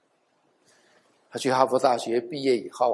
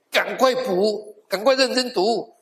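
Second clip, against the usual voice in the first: voicing a father's higher-pitched exhortation; urgent urging; 8 semitones higher, 3 dB louder, slower than usual. Preacher voicing a father telling his son to catch up, urgent. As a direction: urge him on, pitched well up. 转述爸爸催儿子读书.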